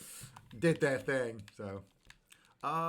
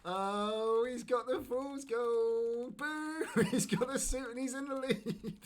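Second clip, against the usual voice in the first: high, drawn-out heckling; mock-dismayed high wail breaking into laughter; playful jeering; 12 semitones higher, 4 dB louder, slower than usual. Real, not acted. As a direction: jeer the leader in a high, stretched voice. Rival takes the gold in game.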